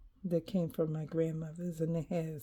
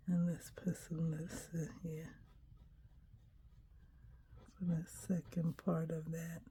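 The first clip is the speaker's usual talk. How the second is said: whisper; 7 dB quieter than usual. Real, not acted. Muttering while coloring ornament.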